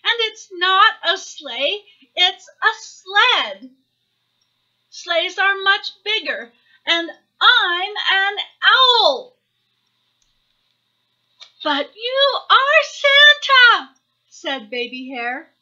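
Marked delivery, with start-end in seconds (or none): 0.0-9.3 high, loud, exasperated little owl; indignant childish cry, building at the end. A narrator's reading. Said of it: "cried Baby Owl". 11.6-13.9 very high, slow, wailing insistence; drawn-out shrieked little hare protest; loud childish insistence. A narrator's reading.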